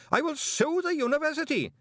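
panicked screaming threat; screamed again. Acted. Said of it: "screamed Greeningham".

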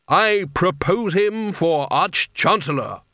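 slow, high-pitched formal proclamation; intoning. A narrator's reading. Carding nominates Coin before the hall, intoning grandly. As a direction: announce the nomination with ceremony. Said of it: "he intoned".

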